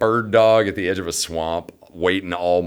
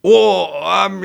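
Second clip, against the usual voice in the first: caught stammer; 11 semitones higher, 9 dB louder than usual. Retold or imitated.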